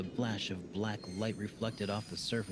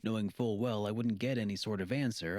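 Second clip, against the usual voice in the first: ordinary delivery, nothing marked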